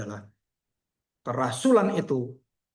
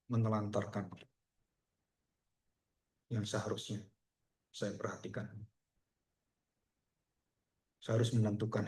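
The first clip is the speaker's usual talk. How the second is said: soft, low, subdued penitent voice; retold tearful confession; 4 semitones lower, 8 dB quieter than usual. Young mother confessing to her pastor, mildly tearful retelling. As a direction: quietly imitate someone confessing through tears, understated. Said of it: "Kenapa kamu nangis-nangis".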